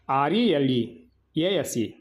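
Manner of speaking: ఆర్ఈఎల్ఈఏఎస్ఈ spelled slowly, each letter apart; slow careful spelling. Shown as a demonstration. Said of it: "చూడండి జాగ్రత్తగా".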